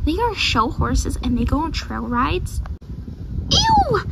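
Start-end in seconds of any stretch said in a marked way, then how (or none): 3.5-4.0 high-pitched "ew" of disgust; disgusted squeal. Acted. Show horse recoiling from trail rides, disgusted squeal. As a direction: squeal it out, appalled.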